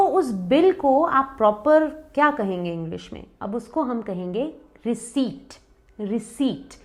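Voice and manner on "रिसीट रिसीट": रिसीट with silent P; softly modelled रिसीट, no P; correct pronunciation. Shown as a demonstration. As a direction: say रिसीट as "ri-seet". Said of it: "correct pronunciation है रिसीट".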